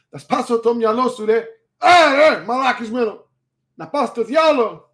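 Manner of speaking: high-pitched mock rant in Greek; strained mock fury; hammed-up angry yelling. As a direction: curse loudly in a comic high voice. Continues acted Greek cursing.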